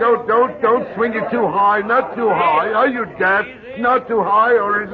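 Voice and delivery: high frantic yells to stop the lift; panicked shouting. Acted. Jeffrey yells at the workmen not to swing it high, panicked shouting. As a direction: shout up in alarm, pitch climbing.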